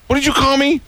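high-pitched indignant outburst; hammed-up screechy indignation; mock screaming. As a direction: imitate an outraged man on the phone. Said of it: "he's screaming at the guy".

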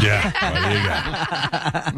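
slow, high, laughing repeat; host laughing as he repeats the joke. Real, not acted. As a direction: chuckle through the words. Repeats the mock line.

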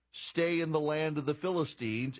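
usual speaking voice

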